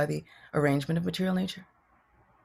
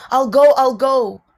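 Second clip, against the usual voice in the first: higher, much louder, slow and insistent; big drawn-out I'll go; eager loud promise; 8 semitones higher, 12 dB louder than usual. Acted. Krishna's eager reply.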